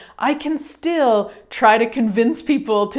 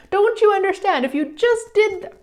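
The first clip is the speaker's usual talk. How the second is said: much higher-pitched, exasperated plea; pleading to convince; 10 semitones higher than usual. Acted. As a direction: beg them to see it your way. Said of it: "trying to convince people there's another way".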